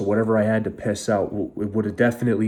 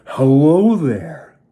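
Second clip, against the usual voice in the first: mocking, drawn-out cheery greeting, pitched up; fake-cheery doctor; 5 semitones higher, 7 dB louder, much slower than usual. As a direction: stretch it out loud, imitating a grinning doctor. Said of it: "comes in with a fucking ear-to-ear smile".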